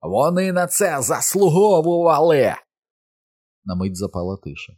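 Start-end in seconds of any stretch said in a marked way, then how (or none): 0.0-2.6 high, loud, drawn-out shout; madly self-righteous yell; crazed scream. A narrator's reading. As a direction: scream it with unrestrained, frightening fury. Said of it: "страхітливо і нестримно закричав на них".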